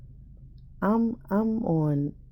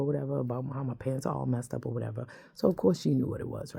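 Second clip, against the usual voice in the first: usual speaking voice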